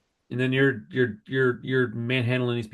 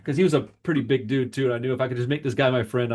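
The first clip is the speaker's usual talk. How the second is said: ordinary talk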